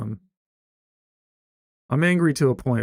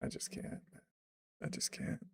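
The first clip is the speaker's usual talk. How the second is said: barely voiced, drained whisper; whispered despair; 8 semitones higher, 18 dB quieter than usual. Real, not acted. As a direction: let the words fade into a tired whisper. Weary confession to chat.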